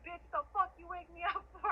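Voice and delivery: high-pitched retold outburst, breaking into laughter; retold cussing out. Telling how they cursed the man who woke them, high laughing outburst. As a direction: cuss them out, half laughing.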